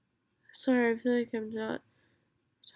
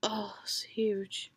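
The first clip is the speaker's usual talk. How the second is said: whisper; 9 dB louder, slower than usual. Real, not acted.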